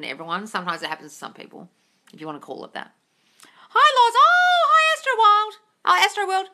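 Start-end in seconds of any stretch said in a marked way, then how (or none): 3.7-6.5 loud, very high squealed hello; gushing high-pitched welcome; effusive greeting. Real, not acted. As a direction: greet a follower with a delighted squeal.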